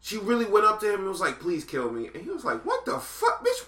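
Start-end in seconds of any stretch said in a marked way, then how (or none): 1.4-2.1 low, soft, lightly voiced plea; a hint of a begging slave; mock pleading. Imitating the slave begging the man for death, low and soft. 2.6-3.7 high, laughing squeal of disbelief; a hammed-up startled man; mock shocked. The man in the movie, laughing and shocked at her request.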